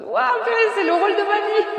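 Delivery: a silly cartoon voice of pride; mock proud. Mother bragging about her pushing, squeaky mock voice.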